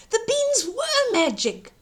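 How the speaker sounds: put-on high, bright excited boy; excited cry. Jack amazed at the magic beans, excited. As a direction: burst out with wonder. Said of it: "Jack cried".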